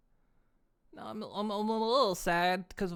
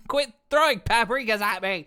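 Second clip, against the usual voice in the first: louder playful complaint; louder hammed-up protest; mock protest; 3 semitones higher, 8 dB louder than usual. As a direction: scold the chat, laughing. Pelted with paprikas, protesting.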